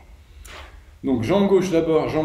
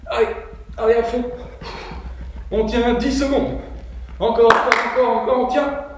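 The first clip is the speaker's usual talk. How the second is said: shouted encouragement; 5 semitones higher than usual. Real, not acted. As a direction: cheer them through the last seconds. Coach pushing class to finish. (encouragements criés, aigus et forts)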